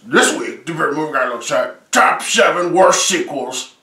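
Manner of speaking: slow trailer-style voiceover; announcer voice. Acted. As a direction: read it like a movie trailer narrator. Show intro voiceover.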